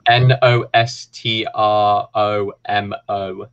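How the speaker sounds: slow letter-by-letter spelling; careful spelling for the listeners; spelled out. Shown as a demonstration. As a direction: spell it out, one letter at a time. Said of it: "You wanna spell that out for people?".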